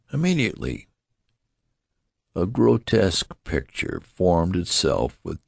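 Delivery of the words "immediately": high-pitched, insistent order. A narrator's reading. Nurse pressing the father to act, insistent. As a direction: insist on it at once. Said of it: "insisted the nurse".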